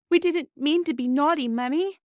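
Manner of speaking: put-on a child's tearful, high plea to his mother; Bobby begging Mummy's forgiveness through his tears, sobbing. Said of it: "sobbed Bobby".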